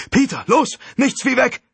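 high, loud shout to flee; urgent yell. A narrator's reading. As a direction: yell a frantic order to get moving. Alicia yells at Peter to flee.

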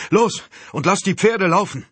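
high, loud command; urgent shouted order. A narrator's reading. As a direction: shout orders to a fleeing companion.

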